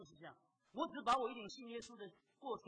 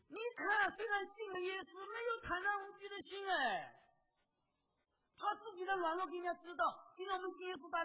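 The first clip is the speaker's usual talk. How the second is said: crying out to the congregation, much higher and louder; full-force preaching; 11 semitones higher, 6 dB louder than usual. Acted. Preacher pleading with the congregation about fearless faith, impassioned preaching. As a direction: preach it with raised voice and a catch of tears.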